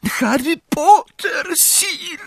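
very high, squealing elf voice; screeching house-elf. A narrator's reading. Trembling elf greets Harry, high-pitched screech. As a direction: screech it, trembling head to toe. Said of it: "zaskrzeczał Zgredek, dygocąc".

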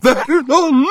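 very high, strangled shriek; choked horror. A narrator's reading. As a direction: gasp out in shrieking panic. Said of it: "wydała kilka dźwięków charakterystycznych dla osób, które się krztuszą". (zduszony, piskliwy okrzyk przerażenia)

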